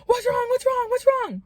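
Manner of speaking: high-pitched panicked rapid repeats; alarmed. Retold or imitated. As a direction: re-enact jolting up in alarm, voice shooting high. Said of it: "I quickly fly up".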